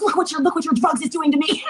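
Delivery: high-pitched theatrical lament; very dramatic. Retold or imitated. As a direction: wail it like a drama queen. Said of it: "I'm very dramatic".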